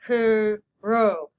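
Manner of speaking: "Hero" in two loud muffled beats; whale talk. Shown as a demonstration. Teacher counting syllables in whale talk. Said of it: "We whale talk it".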